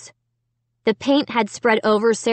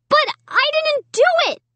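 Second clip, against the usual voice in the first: wailing. A narrator's reading. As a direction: wail your denial. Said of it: "I wailed".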